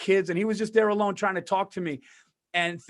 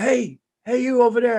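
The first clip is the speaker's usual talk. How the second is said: raised, higher call across the room; 5 semitones higher, 4 dB louder than usual. Retold or imitated. Old patient calling to his roommate, raised. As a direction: call out to the kid in the next bed.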